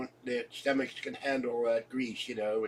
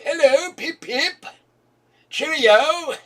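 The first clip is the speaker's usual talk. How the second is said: very high, loud, slow posh British; hammed-up upper-class English accent; posh English caricature; 11 dB louder, slower than usual. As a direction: toff greeting, much higher and slow. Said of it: "Did anybody ever talk like that".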